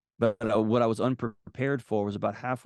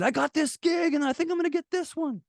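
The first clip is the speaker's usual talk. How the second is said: re-enacted excited cheering. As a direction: cheer the news in a high, giddy voice. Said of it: "I'm yelling, I'm cheering".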